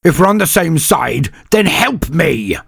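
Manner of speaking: high, loud demand; retorting shout. A narrator's reading. Vitko demands help from Senyavina, a loud retort. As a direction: throw it back at her.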